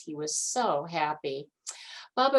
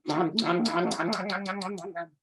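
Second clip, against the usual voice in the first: eating noises; faster than usual. Acted. Bear gobbling.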